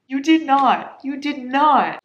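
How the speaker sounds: excited disbelief. Real, not acted. Reacting to tabbed book.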